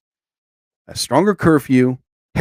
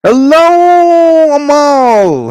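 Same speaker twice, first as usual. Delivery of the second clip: much higher, loud sing-song hello; excited greeting; 12 semitones higher, 8 dB louder, much slower than usual. Real, not acted. Streamer greeting a viewer named Amal, high loud sing-song. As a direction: call out the name brightly.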